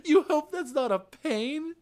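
high, slow, laughing disbelief; drawn-out high-pitched question through laughter; incredulous laughing echo. Real, not acted. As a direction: repeat it back cracking up. Mockingly echoes the friend's order.

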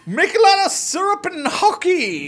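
very high, slow cartoon Canadian voice through laughter; a laughing hammed-up Canadian; mock Canadian accent. Answering Justin, a mock Canadian through laughs.

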